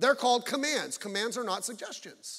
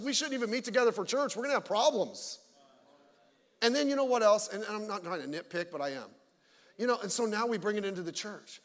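ordinary talk

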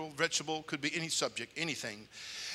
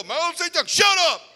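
much higher, loud mocking friends; buddies teasing; 13 dB louder than usual. School friends mock him in hallway, high and loud. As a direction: tease him in a high yell. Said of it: "my buddies go".

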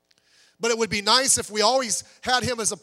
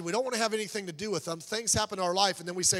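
normal talking voice

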